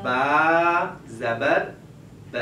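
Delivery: با زبر spelled very slowly, then ب short; slow spelling demo. Shown as a demonstration. Teacher slowly models the reading for the child. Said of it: "غلط پڑھ دیا، اس لیے کہ اس نے کھینچ کے پڑھا".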